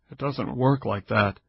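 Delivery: ordinary delivery, nothing marked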